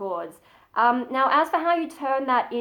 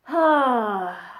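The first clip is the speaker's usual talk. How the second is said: long easy sigh out; relaxed sigh; 3 dB louder than usual. Shown as a demonstration. Teaching false chord basics, a relaxed sigh. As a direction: let the breath fall out in a sigh. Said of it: "if you think about a sigh".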